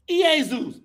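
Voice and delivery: ordinary delivery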